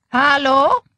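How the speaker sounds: put-on calling out loud and high with a German accent; heavy German accent. A narrator's reading. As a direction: hail them in a thick German accent. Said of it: "called in her heavy German accent".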